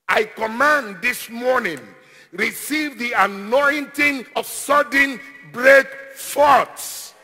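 loud, commanding declaration; emphatic, drawn-out preacher's proclamation; forceful preaching. Real, not acted. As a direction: pronounce the blessing with mounting force. Commands blessings on congregation.

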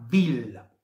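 "Vil" with an initial v, drawn out; German pronunciation. Shown as a demonstration. Tipster gives the Swiss German way, "Vil" starting on v. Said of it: "se pronunță".

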